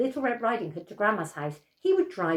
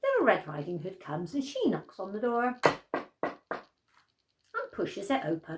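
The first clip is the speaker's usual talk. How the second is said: ordinary delivery, nothing marked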